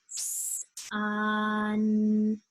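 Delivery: sun drawn out sound by sound; stretched slowly. Shown as a demonstration. Teacher to class, stretching "sun" slowly. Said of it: "I'm going to say it really slowly".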